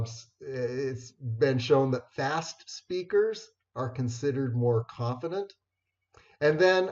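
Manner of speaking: ordinary delivery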